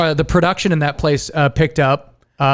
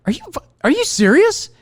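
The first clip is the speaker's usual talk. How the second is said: high, shocked question; disbelieving boss; 8 semitones higher than usual. Retold or imitated. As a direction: sound floored by the news. Boss shocked at blocked promotion.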